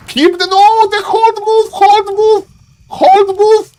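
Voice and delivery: high-pitched, urgent pleading at the player; panicked caster yelling advice in a high voice; frantic coaching. Real, not acted. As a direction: cry out in alarm during a live match. Urgent calling out during game.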